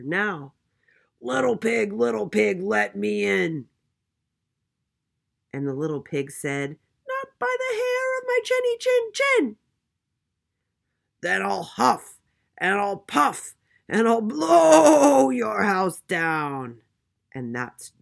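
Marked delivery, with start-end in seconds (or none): 1.2-3.7 loud, raised wolf voice, stretched at the end; menacing storybook wolf call; big bad wolf. A narrator's reading. Wolf demands entry again. 7.1-9.6 very high, loud piglet voice; squealing little pig; little pig crying out. A narrator's reading. Pig refuses the wolf. 11.2-16.8 loud, higher wolf voice with a long-drawn swell; huffing, puffing storybook villain; big bad wolf threat. A narrator's reading.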